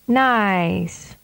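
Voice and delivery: "Nice." with the vowel held long; drawn-out "Nice.". Shown as a demonstration. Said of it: "and then with a longer duration".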